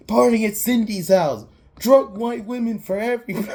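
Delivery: high, loud mock party shout; imitated hollering. Shorty spreading word of the party, mock excited holler. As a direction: whoop it out to the whole crowd.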